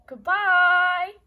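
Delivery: loud, bright call-out goodbye; loud cheerful goodbye. Real, not acted. Calling goodbye to viewers.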